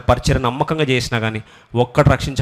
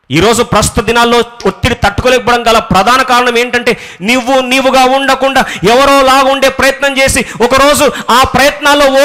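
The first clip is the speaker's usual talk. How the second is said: higher, louder impassioned sermon climax; full-force preaching; 10 semitones higher, 6 dB louder than usual. Acted. Preacher rebuking the congregation, impassioned full-force preaching. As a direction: drive it home to the congregation.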